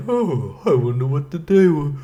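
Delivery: high, yawning groggy moan; mock sleepy. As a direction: groan as if barely awake. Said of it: "being sleepy and like".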